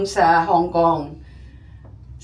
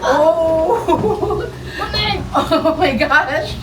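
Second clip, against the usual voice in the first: scared giggles on a shaky ride; nervous laughing; 7 semitones higher, 3 dB louder, much slower than usual. Real, not acted. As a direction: laugh through the fright. Gondola swaying, laughing scared.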